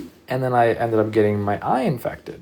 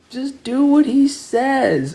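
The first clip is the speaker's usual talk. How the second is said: much higher, slow, put-on bossy sister voice; imitating sister; 6 dB louder, slower than usual. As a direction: mimic the sister brushing it off, slowly. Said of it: "And she's like".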